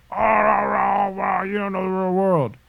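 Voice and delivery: mocking shout. Caricaturing someone scoffing that you don't know the real world. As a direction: exclaim in loud high mockery. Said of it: "when you're".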